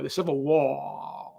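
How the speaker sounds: very slow Delta drawl stretching "war" into three syllables; Shelby Foote drawl. Retold or imitated. Imitating Shelby Foote saying war as a three-syllable word. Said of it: "he would go".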